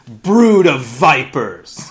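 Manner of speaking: slow, very loud drawn-out rebuke; booming rebuke. Retold or imitated. Preacher booming John's rebuke at the leaders. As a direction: thunder it at them like a prophet.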